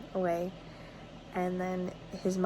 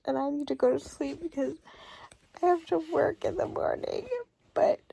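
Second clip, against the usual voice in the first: tearful; 8 semitones higher than usual. Real, not acted. Overwhelmed by the book at midnight.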